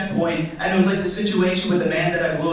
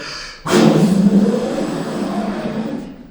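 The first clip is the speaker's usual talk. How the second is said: long forceful exhale; hard blowing; 3 semitones higher, 4 dB louder than usual. Acted. Suspect taking the breathalyzer test, blowing hard. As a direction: blow hard as if into a balloon. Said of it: "I want you to blow on this like".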